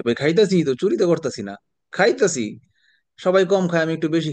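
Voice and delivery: mock defensive. Mock defense of eating a lot.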